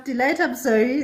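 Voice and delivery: usual speaking voice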